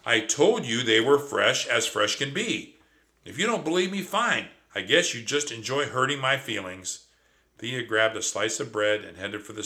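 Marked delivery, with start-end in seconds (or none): 0.0-7.1 loud, hurt indignant rant; offended. Acted. Trap offended by his cousin, loud hurt rant. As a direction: sulk loudly, wounded pride.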